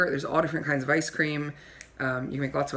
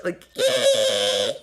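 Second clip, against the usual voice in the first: a loud lower screech mocking the guest's voice; mock shriek; 5 semitones lower, 6 dB louder than usual. Host mocks the guest's accent with a loud screech. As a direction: bray it out, loud and ugly. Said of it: "like a shrieking sound".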